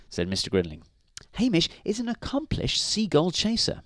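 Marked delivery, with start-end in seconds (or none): 1.3-3.8 very high, eager woman's voice; high-pitched wifely enthusiasm; exclaiming wife. A narrator's reading. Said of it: "exclaimed Missus Grinling".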